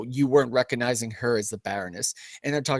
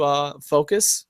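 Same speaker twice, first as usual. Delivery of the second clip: normal talking voice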